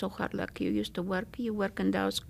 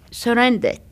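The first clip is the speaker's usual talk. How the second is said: suddenly loud, the word bursting out; 3 semitones higher, 10 dB louder than usual. Real, not acted. Survivor recalling the German surrender, blurting it loud. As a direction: blurt it out once she finds the word.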